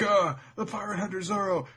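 high, loud mock crowd alarm; hammed-up henchmen raising the alarm; panicked goobers. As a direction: stammer out a cartoonish cry of alarm. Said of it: "a whole bunch of goobers are like".

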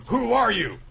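high, loud startled question; hammed-up high alarm; shocked gasp. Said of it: "it's like gasps".

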